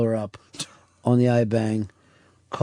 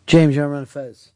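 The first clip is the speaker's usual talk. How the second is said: normal talking voice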